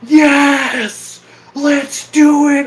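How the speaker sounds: high, loud, stretched event holler; shouting out. Shown as a demonstration. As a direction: shout it high like a drunk fan. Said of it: "wherever you have to shout out something".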